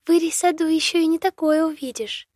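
high giggly bird voice; giggling. A narrator's reading. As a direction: giggle through the line. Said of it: "прохихикала птичка". (хихикая)

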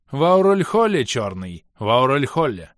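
high, loud shout dipping mid-way; shouted answer. A narrator's reading. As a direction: shout the place across to a rival.

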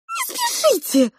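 very high, slow mouse squeak; pleading mouse. A narrator's reading. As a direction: beg in a tiny piping voice. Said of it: "умоляюще сложила лапы розовая мышь". (умоляющий мышиный писк)

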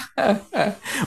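short, high breathy laugh; a quick laugh of emotion; nervous laugh. Real, not acted. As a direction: laugh at yourself, overwhelmed. Laughing at his own emotion.

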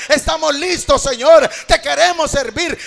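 loud, ringing revival preaching over the music; full-force preaching. Acted. Pastor exhorting the congregation to receive blessing, loud full-force preaching. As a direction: preach it out to the whole church, loud.

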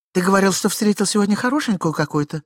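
high put-on wife's voice; high, prying woman; questioning wife. A narrator's reading. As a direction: question your husband in a high voice. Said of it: "спросила жена".

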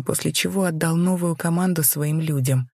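plain reading voice

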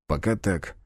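plain reading voice

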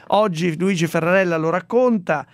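ordinary talk, nothing marked

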